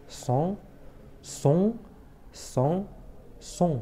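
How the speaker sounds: son, son, son, son each said apart, rising; homophone demo. Shown as a demonstration. Teacher to class, spelling out each son slowly. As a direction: sound out each son clearly as a separate word. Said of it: "ما كيتنطقوش فحال فحال".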